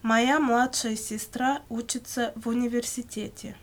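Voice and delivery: ordinary delivery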